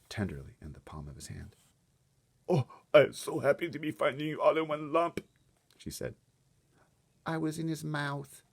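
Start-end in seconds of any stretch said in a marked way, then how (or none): 2.5-8.4 put-on higher, relieved girl voice; little girl's voice. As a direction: speak as the small girl, shaken. Sophie relieved.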